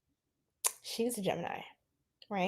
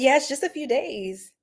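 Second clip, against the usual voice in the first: ordinary talk, nothing marked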